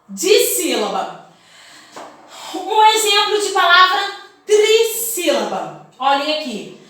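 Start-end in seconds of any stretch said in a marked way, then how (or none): none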